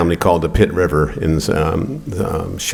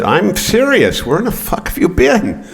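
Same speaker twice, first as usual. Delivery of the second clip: much higher, slow, insistent mother; insistent mom; 13 semitones higher than usual. Retold or imitated. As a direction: press it like a mother who will not be brushed off. And she said, "I'm serious.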